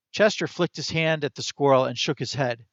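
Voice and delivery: usual speaking voice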